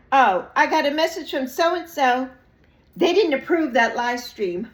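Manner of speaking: loud, high indignation; indignant report. Retold or imitated. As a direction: tell it loud and outraged. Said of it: "somebody has said to me".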